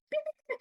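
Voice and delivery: high, quiet squeaky beeps; sped-up gibberish voices. Retold or imitated. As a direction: imitate tiny fast-forwarded chatter in high beeps. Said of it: "like, really fast and, like, weird fucking".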